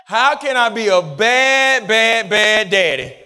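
slow, drawn-out repeated BAD; slow rhetorical question, leaning on "bad"; deliberately slow. Shown as a demonstration. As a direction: stretch each "bad" out slowly. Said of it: "I'm gonna say it again slow".

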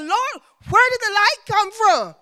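high, loud cry to God; calling out. Retold or imitated. Preacher retells asking the Lord, calling out high.

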